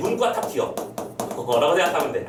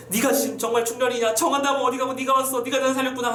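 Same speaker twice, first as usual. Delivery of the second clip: high-pitched acted amazement and gratitude; dramatized emotional relief; overwhelmed disbelief; 6 semitones higher than usual. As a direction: marvel at your rescuer, pitch held high. Rescued emperor marvels at general.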